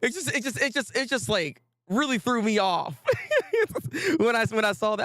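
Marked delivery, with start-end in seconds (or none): 3.0-4.1 squeaky high laugh; high burst of laughter. Real, not acted. Streamer laughing that the plot finally moves, very high laugh.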